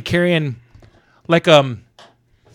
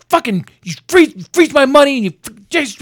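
high, sputtering imitation of a ranting man; flipping out; 10 semitones higher, 4 dB louder than usual. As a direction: act out someone losing it, tripping over the words. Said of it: "just fucking flipping out".

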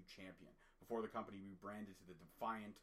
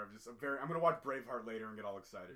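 ordinary delivery

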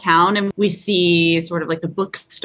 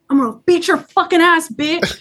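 raised, high-pitched tough-guy threat; mock screaming and cursing; 7 semitones higher than usual. Co-host imitating the brother chasing an intruder, mock yelling. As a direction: imitate a badass cursing, voice pushed up. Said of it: "he's, like, screaming and, like, cursing".

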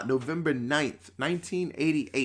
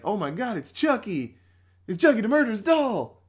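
higher-pitched, building fake amazement; mock crowd astonishment; 7 semitones higher, 4 dB louder than usual. Crowd spots Chucky at wrestling, mock astonishment. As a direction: gasp it like a hammed-up crowd. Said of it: "And everybody's like".

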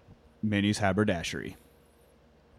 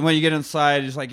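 ordinary talk, nothing marked

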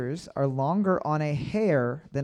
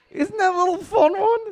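much higher, sing-song cutesy voice; perky host teasing the players; 13 semitones higher than usual. Acted. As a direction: sell it like a perky quiz host. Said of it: "using that exact tone of voice".

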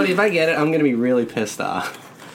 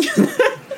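high laugh; 3 dB louder than usual. Real, not acted. Laughs at teasing.

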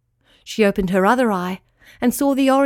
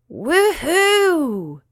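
star's whoop; 7 semitones higher than usual. A narrator's reading. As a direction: whoop with delight as you fly. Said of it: "cried the star".

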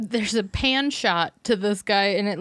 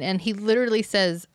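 ordinary delivery

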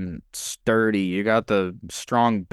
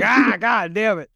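loud high yelp of a curse; stubbed toe, swear out loud; pained curse; 9 semitones higher, 5 dB louder than usual. Retold or imitated. Imitating the stumble curse.

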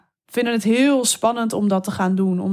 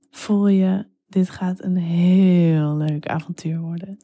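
slow, low sensual narration with long pauses; erotic storytelling; 3 semitones lower than usual. Acted. Telling a listener an erotic story about a lover, slow and sensual. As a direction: draw the words out slowly, lingering and intimate.

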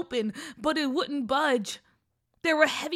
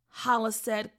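ordinary delivery

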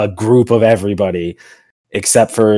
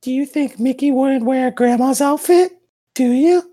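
high teasing voice. Acted.

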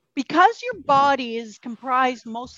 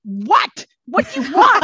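louder, laughing, fed-up snap; irritated snap; 7 dB louder than usual. Retold or imitated. To her boyfriend on the phone, mock irritated and loud. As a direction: bite his head off, half laughing.

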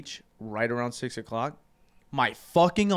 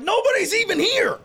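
loud, high-pitched retold protest; incredulous high holler; exasperated mock yell; 6 dB louder than usual. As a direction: yell it like you can't believe the DJ. Acts out yelling at the DJ.

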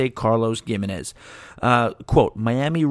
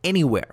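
"anywhere" pitched up and punched; emphatic anywhere; 5 semitones higher than usual. Shown as a demonstration. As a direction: lean hard on "anywhere". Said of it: "That's right".

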